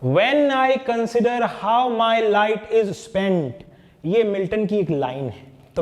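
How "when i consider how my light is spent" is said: slow, measured line of poetry; slow verse reading. Shown as a demonstration. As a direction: read the verse out slowly, drawing it out. Reads out the poem line.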